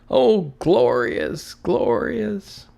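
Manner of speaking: high, slow, drawn-out rapture; rapturous. A narrator's reading. Scrooge hearing the church bells ring, rapturous. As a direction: savour the word glorious.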